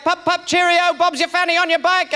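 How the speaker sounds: high, babbling British mum parody; English mother's accent. Retold or imitated. Son parodying his mother's British nonsense, high English accent. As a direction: spout British slang in a high mock accent. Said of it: "She'd be like".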